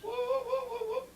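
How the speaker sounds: high falsetto ape hoots; gorilla whoops. Retold or imitated. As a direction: hoot like a swinging gorilla. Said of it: "you make some noises".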